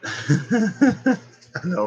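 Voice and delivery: high, loud laugh into the words. Real, not acted. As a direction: laugh at your own slip while talking. Laughing at repeating herself.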